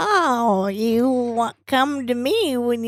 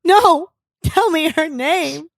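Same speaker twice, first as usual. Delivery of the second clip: angry wife; 6 semitones higher, 5 dB louder than usual. Acted. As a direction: threaten to leave, fired up. Acted jealous wife confrontation.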